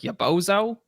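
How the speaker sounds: Brooklyn-style "Ya bozo?" with a rising end; New York accent. Retold or imitated. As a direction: toss off "Ya bozo?" like a New Yorker. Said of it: "he always says it in a New York accent".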